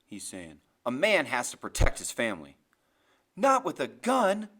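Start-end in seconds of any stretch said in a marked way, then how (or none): 0.9-2.6 low, loud, insistent husband; stern Grim. Acted. Narrator voices Grim arguing. 3.4-4.5 high, slow, loud protest; yelling. A narrator's reading. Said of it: "Graham yells".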